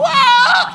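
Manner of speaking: high, loud startled scream; mock scream. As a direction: imitate the woman shrieking at the door. (kunwaring sigaw ng gulat na babae)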